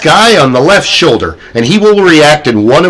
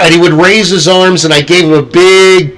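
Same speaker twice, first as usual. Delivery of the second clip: ordinary delivery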